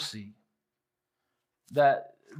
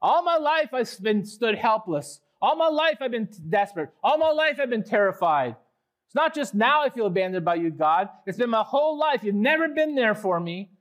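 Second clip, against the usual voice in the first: higher, louder overblown lament; exaggerating psalmist; 7 semitones higher, 4 dB louder than usual. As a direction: pile it on like a wronged sufferer. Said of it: "He's exaggerating".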